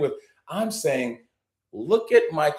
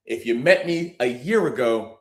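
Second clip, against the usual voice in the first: ordinary talk, nothing marked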